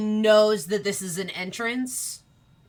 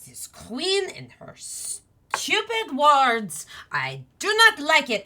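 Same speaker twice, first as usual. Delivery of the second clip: hammed-up frustrated outburst, rising high and louder; Chess; 6 semitones higher, 4 dB louder, slower than usual. Chess falls back from the locked door, acted angry complaint. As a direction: grumble into a drawn-out mock tantrum. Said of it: "like, loud".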